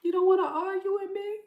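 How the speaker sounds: high, quick, incredulous reply; lightly mocking retort. The other person replying, a light high disbelief. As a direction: toss it back in a high, fast disbelieving voice. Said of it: "your person is like".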